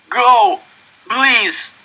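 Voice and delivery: loud, high begging cry; desperate plea. A narrator's reading. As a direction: urge a friend to save himself. Beaten friend begs boy to leave.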